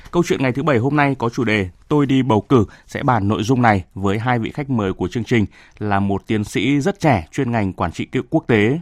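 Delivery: ordinary talk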